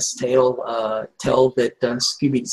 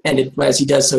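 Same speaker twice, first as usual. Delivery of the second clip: usual speaking voice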